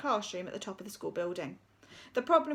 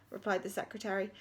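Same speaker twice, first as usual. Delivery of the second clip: usual speaking voice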